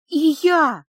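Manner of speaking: high, loud cry of recognition; overwhelmed outburst. Acted. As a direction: burst out, overcome with emotion. Зарина обняла меня за шею и зарыдала.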